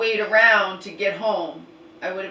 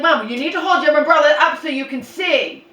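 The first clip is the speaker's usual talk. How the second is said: loud scolding; 7 semitones higher, 9 dB louder than usual. Retold or imitated. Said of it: "I'm like".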